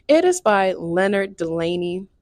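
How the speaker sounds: normal talking voice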